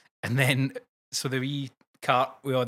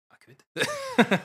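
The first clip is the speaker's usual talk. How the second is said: tempted; 4 dB louder than usual. Pretend. Tempted by the door lever.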